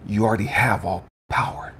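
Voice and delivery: very low, hushed divine voice; God's quiet answer. Retold or imitated. God answers the dying man, quiet and low. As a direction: speak softly and deep, with weighty stillness.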